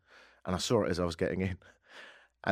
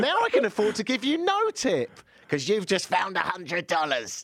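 mock gloating high voice; 10 semitones higher, 3 dB louder than usual. Mocking the driver in retold scene.

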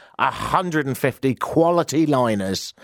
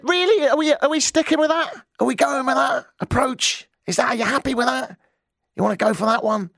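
high, indignant string of questions; incredulous challenge; 13 semitones higher than usual. Retold or imitated. Telling listeners how he challenged the man at the bar, incredulous. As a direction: pitch it way up in mock disbelief. Retelling his indignant reply in pub argument.

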